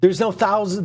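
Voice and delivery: ordinary talk, nothing marked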